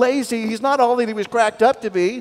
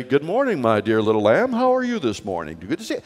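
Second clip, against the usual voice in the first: deep God voice; 11 semitones lower than usual. Pretend. Friend mocks God speaking to a lamb, deep and low. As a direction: boom it like a gentle God. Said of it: "Did he have a deep voice?".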